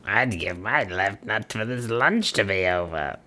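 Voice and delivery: put-on dusty, rasping old ghoul voice; Crypt Keeper voice. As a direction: rasp it out like a dried-up ghoul. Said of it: "The Crypt Keeper says".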